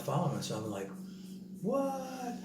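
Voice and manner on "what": sudden high disbelieving what; surprised. Retold or imitated. Watching from the window, surprised nobody followed. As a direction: caught off guard, pitch jumps up.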